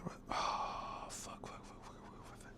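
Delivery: a rapid string of curses under the breath, barely voiced; real whispered frustration; whispered cursing. Real, not acted. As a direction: swear to yourself so nobody hears. Cooking goes wrong on camera.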